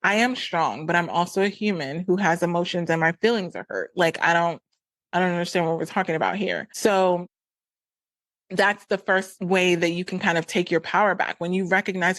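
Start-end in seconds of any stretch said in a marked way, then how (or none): none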